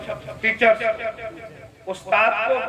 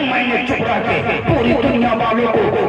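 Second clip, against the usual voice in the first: impassioned, raised sermon climax; full-force preaching; 2 semitones higher, 6 dB louder than usual. Real, not acted. Preacher declaring to the congregation who the world's teacher is, full-force preaching. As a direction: proclaim it to the crowd, pitch lifted.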